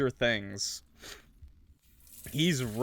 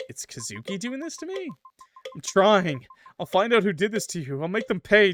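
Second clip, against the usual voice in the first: desperate pleading; 8 semitones higher, 5 dB louder than usual. Acted. Voicing player character to ghost.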